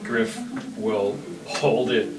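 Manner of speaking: usual speaking voice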